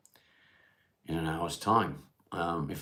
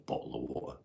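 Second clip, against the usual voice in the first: softer, lower "bottle of water" in casual English accent, t's dropped; everyday English accent; 5 semitones lower, 5 dB quieter, faster than usual. Shown as a demonstration. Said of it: "that's what they're looking for".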